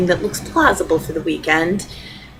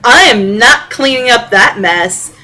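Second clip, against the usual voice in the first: put-on louder, defiant teen shout; shouting back; 4 semitones higher, 6 dB louder, slower than usual. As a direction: yell it back from the other room. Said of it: "shouts back".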